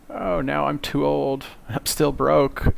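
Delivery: mildly whiny, drawn-out mock regret; mock lament. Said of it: "fifty-something years old and be like".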